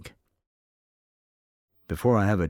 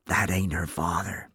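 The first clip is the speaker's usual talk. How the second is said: ordinary delivery, nothing marked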